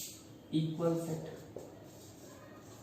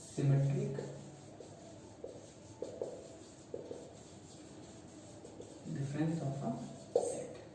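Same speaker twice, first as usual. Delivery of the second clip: slow dictation; 11 dB quieter, much slower than usual. Shown as a demonstration. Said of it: "ठीक है?".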